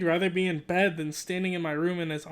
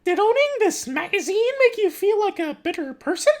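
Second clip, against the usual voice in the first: loud, very high falsetto mom; Mickey Mouse mom; 13 semitones higher, 7 dB louder than usual. Acted. Mom questions Roderick about the magazine, loud Mickey Mouse falsetto. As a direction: question him in a piercing cartoon-mouse mom voice. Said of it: "she's Mickey Mouse".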